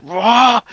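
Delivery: hammed-up roaring battle cry; battle yell. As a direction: yell it out as you charge. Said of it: "I just yell with my deepest voice".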